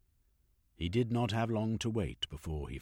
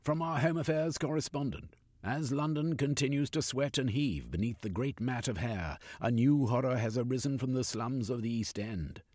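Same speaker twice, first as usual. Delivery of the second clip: higher, declaiming news report; newspaper read aloud; 4 semitones higher than usual. Acted. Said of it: "read the opening paragraph aloud".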